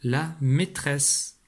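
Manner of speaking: la maîtresse said very slowly; slow model for a young reader; very slow model reading. Shown as a demonstration. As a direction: drag out la maîtresse. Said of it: "ça donne donc le mot".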